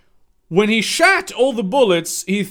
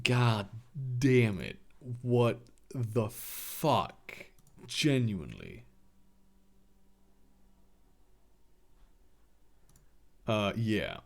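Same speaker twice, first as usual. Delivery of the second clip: much lower and softer, trailing off; despairing mutter; 11 semitones lower, 14 dB quieter than usual. Real, not acted. Reader appalled by the story, low muttered disbelief. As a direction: groan under your breath at the fic.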